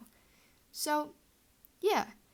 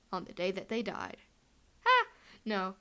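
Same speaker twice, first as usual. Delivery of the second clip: normal talking voice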